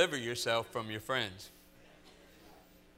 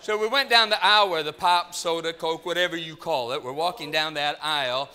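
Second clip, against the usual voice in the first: ordinary delivery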